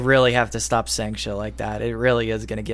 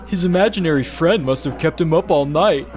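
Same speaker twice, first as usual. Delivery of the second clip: mean mocking laugh; 3 semitones higher than usual. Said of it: "Mean laughter".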